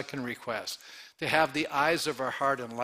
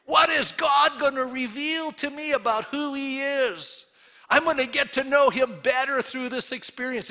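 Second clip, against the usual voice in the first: much higher, louder, slowed delighted voice; eager excitement; 10 semitones higher, 5 dB louder than usual. Retold or imitated. Pastor acting out a joyful believer in trials, high and delighted. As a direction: sound thrilled to be tested. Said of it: "have an attitude going".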